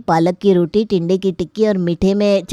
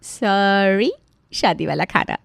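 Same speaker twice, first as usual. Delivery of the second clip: softer, playful storyteller voice; chatty comic narration, quieter than the characters; story narration; 5 dB quieter than usual. Acted. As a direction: narrate the family comedy to the audience.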